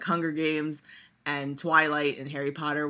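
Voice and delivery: usual speaking voice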